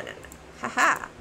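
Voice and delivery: ordinary talk, nothing marked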